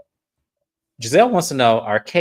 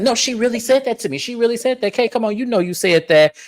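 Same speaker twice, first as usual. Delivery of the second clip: high, pressing mock insistence; lightly voiced insistent Giselle; insisting; 9 semitones higher than usual. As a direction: push it at her, pitched up. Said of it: "And Giselle said".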